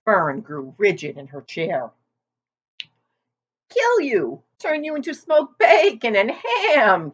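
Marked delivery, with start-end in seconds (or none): 3.7-7.1 put-on higher, louder old ewe; a gossipy old sheep leaning in. A narrator's reading. Old sheep teasing Wilbur about his weight, higher and louder.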